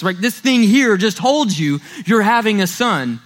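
ordinary delivery

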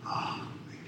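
soft breathy "oh man"; hushed awed whisper. Real, not acted. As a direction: breathe it out in awe. Looking back, awed realization.

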